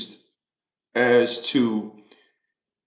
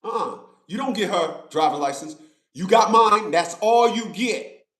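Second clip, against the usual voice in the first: higher, firm "oh partner" warning to the cop; retold standoff; 6 semitones higher than usual. Driver retelling telling off a state trooper, firm warning. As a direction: set the trooper straight, firmly. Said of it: "watched me".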